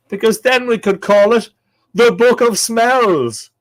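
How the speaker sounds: loud, high punchline, drawn out at the end; booming punchline. Pretend. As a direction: belt out the silly pun. Delivers punchline of own pun.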